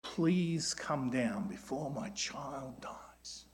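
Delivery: soft prayer. Acted. Preacher voicing the official's plea as a soft, slow prayer. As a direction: breathe it out as a gentle plea. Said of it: "framed like a prayer".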